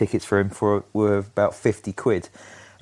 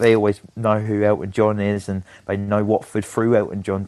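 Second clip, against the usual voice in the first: ordinary talk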